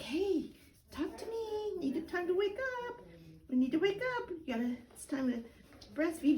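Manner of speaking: put-on high, sing-song coaxing to a sleepy baby; cooing wake-up voice. Shown as a demonstration. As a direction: coo the baby awake, lilting and high. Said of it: "doing something like".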